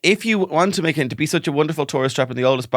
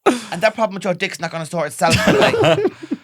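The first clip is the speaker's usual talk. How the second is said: higher, louder, fast nagging wife; mock furious wife; 5 semitones higher, 4 dB louder than usual. Playing a wife scolding her husband over spaghetti. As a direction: rant like a fed-up Irish mammy. Pretend marital row.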